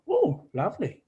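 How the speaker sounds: imitating a friend's high little voice; high-pitched friend voice. Fijian friend thanking for gloves, imitated in a high voice. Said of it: "He's got a real high-pitched voice".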